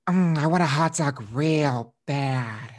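slow, breathy put-on craving voice; Danny DeVito impression. Host doing Danny DeVito as a joke, slow and drawn out. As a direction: drag out the hot dog craving slowly. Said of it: "that was my, um, Danny DeVito".

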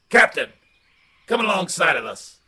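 loud call across water. Acted. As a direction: call out to the other boat. Calling out to another canoe.

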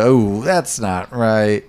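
very slow, dragged-out dismay; slow deadpan quote. Retold or imitated. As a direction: draw it out like a stunned crewman. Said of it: "then just the".

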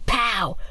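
shouted pow. Acted. Ava acts out gunfire.

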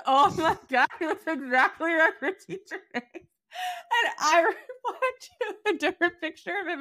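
high-pitched, cracking up mid-story; words breaking into laughs; laughing while talking. Real, not acted. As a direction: tell it through helpless laughter.

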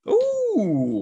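loud, high-pitched falling "ooh". Pretend. Host between picks, playful high "ooh". Playful exclamation before sipping water.

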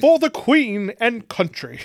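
slow, high, hammed-up British-Georgian delivery; mock British-Georgian accent. Co-host mocking the accent in South America, British-Georgian. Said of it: "British slash Georgian accent".

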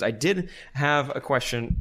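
ordinary talk

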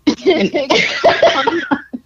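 loud, high-pitched laughing fit; uncontrolled laughter. Real, not acted. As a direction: crack up helplessly, loud. Cracking up at ambulance joke.

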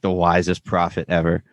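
usual speaking voice

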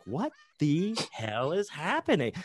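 high, slow, stretched-out disbelief; incredulous freak-out. Acted. After an hour gone with a drug dealer, incredulous. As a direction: draw out each word in alarm. Reaction to an hour's absence.

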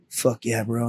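low, soft retold cheer; understated approval. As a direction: murmur it coolly like the getaway driver. Buddy's cool understated approval.

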